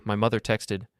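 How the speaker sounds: usual speaking voice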